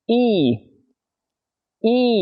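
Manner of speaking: Y drawn out high and tense; a tight English ee with the lips spread; model sound. Shown as a demonstration. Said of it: "Chỉ nghe, lắng nghe nè".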